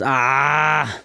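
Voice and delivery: high garbled yelling; imitated scream. Retelling a stranger's outbursts, mock screaming. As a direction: screech out slurred nonsense, imitating her. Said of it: "Sometimes she would just scream".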